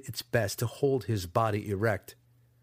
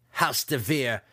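mocking laugh; 3 semitones higher, 4 dB louder, slower than usual. A narrator's reading. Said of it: "the faceless one laughed".

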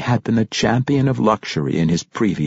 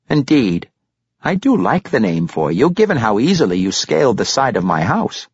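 approving grandfather, a little pitched up; lightly voiced Pericolo; 6 semitones higher, 4 dB louder than usual. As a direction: show mild admiration in character. Narrator voices genial Pericolo.

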